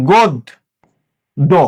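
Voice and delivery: গোদ / দো, first অ as ও, two beats with a pause; syllable-split pronunciation. Shown as a demonstration. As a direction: sound out গোদ, then দো, doubling the দ. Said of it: "তাহলে কি হবে?". (ভেঙে ভেঙে উচ্চারণ: গোদ, দো)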